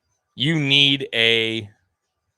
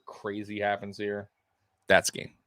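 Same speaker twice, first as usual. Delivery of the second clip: ordinary talk, nothing marked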